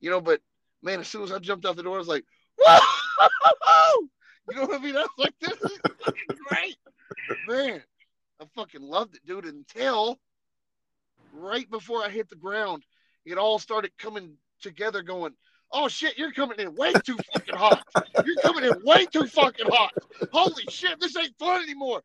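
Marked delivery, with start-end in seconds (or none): 2.6-4.1 very high, loud wavering woo breaking into laughter; thrilled scream of "woo"; exhilarated whoop. Retold or imitated. Acts out his jump from plane. 5.4-6.8 high, laughing excitement; giddy, high-pitched delight; retold elation. Acts out joy in the air. 15.7-22.0 high, half-laughing panic; mock panic as the ground rushes up; rising "oh shit" dread, played for laughs; retold alarm. Acts out alarm before hard landing.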